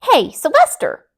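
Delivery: loud high bossy call; demanding call. A narrator's reading. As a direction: call him out like a boss. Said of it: "demanded Delilah".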